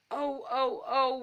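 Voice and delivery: a pixie's dismayed little wail; understated wailing oh oh oh. A narrator's reading. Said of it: "wailed Bubbly".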